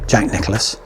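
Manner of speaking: normal talking voice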